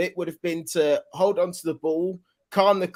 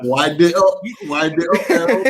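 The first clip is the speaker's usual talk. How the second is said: louder call to wrap it up; 7 dB louder than usual. Acted. Telling Errol to wrap up his rant, loud call. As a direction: shout him down playfully. Calling out to cut him off.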